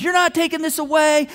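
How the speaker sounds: high, loud acted defiance; belligerent. Daughter resisting dad taking her phone, belligerent. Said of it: "she becomes belligerent".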